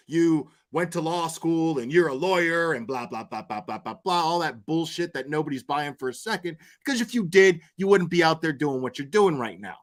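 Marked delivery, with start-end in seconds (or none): none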